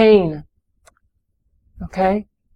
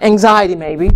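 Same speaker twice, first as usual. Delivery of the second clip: normal talking voice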